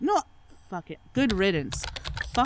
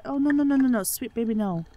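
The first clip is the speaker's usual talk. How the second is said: higher, hammed-up near-crying plea; a touch of fake tears; mock tearful pleading; 5 semitones higher than usual. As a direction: pretend to choke up as you beg. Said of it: "Oh man, I'm starting to cry".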